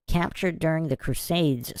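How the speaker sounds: ordinary talk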